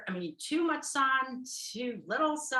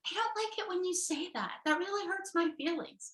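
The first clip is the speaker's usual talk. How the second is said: high, put-on hurt voice; mock wounded partner, pitched way up; sensitive partner; 8 semitones higher, faster than usual. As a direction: act out hurt feelings in a high little voice. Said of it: "I don't know why I'm talking like this".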